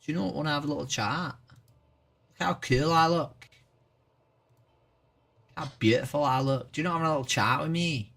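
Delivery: playful put-on Irish lilt, quick; mock Irish accent. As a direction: tease the chat in a hammed-up Irish accent. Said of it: "Have you never heard an Irish accent before?".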